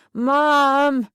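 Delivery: put-on drunken bellow; yelled out. A narrator's reading. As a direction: yell for someone downstairs. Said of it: "Jason yelled out".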